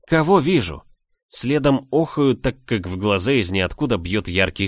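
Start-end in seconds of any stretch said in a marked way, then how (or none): 0.0-0.8 high, louder startled outcry; startled cry. A narrator's reading. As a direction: cry out, pitch jumping up. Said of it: "вскрикивая". (вскрикнуть испуганно, высоко)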